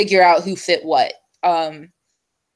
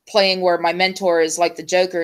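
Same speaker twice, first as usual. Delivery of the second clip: usual speaking voice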